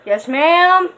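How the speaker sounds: loud answering yes ma'am; calling back. Real, not acted. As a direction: call out to mom in the other room. Answering a call from offscreen.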